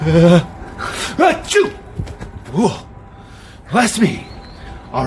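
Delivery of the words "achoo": pretend sneeze.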